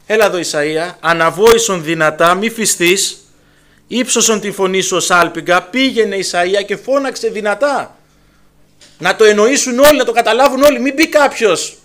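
fervent preacher's charge; preaching at full force. Acted. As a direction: proclaim it like a trumpet. Preaching God's command at force.

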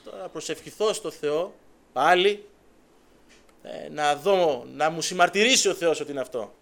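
loud, emphatic resolve to pray again. Retold or imitated.

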